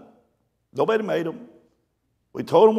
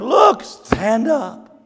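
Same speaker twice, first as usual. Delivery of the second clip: slow loud shout; 7 semitones higher, 4 dB louder, much slower than usual. Retold or imitated. Pastor in the river yelling to panicking ladies, slow loud shout. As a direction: call out slowly, high and loud.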